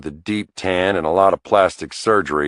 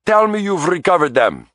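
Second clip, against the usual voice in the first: put-on high, pleading Italian accent; an Italian accent begging the detective for good news; thick Italian accent; 10 semitones higher than usual. A narrator's reading. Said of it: "in a thick Italian accent".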